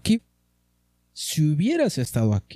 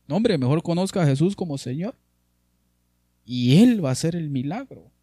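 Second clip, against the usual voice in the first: ordinary talk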